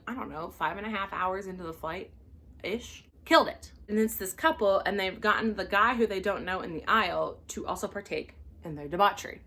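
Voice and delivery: ordinary delivery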